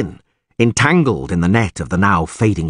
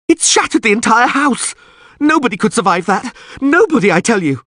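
panicked shouting; 4 dB louder than usual. A narrator's reading. Dandelion panicking after explosion.

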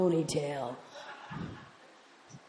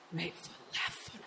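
very soft, voiceless whisper; whispered self-coaching; 11 dB quieter than usual. Retold or imitated. Stoned woman working out how to walk, whispered self-coaching. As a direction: whisper each step to yourself.

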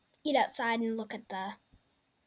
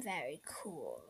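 low, soft, half to yourself; quiet murmur; 7 semitones lower, 13 dB quieter than usual. Acted. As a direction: let it sink down to a hush. Kid murmurs over the sky.

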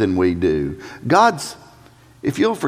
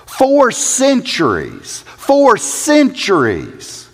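Four centuries drawn out slowly, higher; slow emphasis; 9 semitones higher, much slower than usual. Real, not acted. Preacher stressing the long wait, slow and high.

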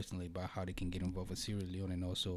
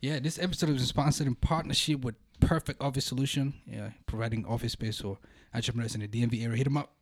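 upbeat promo read; sponsor ad read; 3 semitones higher, 5 dB louder than usual. Acted. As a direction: pitch it like an ad. Reads sponsor ad.

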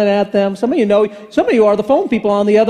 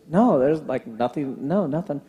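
much lower, softer mumbled no; imitating Kent's deflated reply; quiet; 8 semitones lower, 7 dB quieter than usual. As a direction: drop low and quiet, deflated. Kent's flat refusal.

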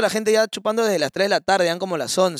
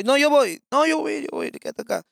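imitating friend; 8 semitones higher than usual. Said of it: "Me dijo".

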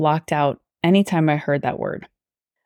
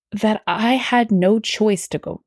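normal talking voice

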